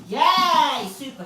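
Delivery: high, loud cheer; delighted whoop of praise; excited praise. Real, not acted. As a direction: celebrate the dog's success. Praising the dog.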